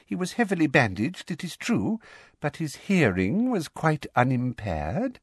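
high, smug little voice; self-satisfied testimony; Umbridge, smug. A narrator's reading. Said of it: "said Umbridge smugly".

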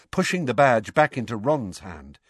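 usual speaking voice

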